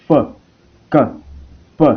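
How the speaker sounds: ordinary delivery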